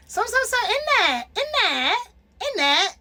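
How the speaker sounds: high mocking British "in that" with a bouncing rise; mock British accent. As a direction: parody the British "in that". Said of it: "like the British accent".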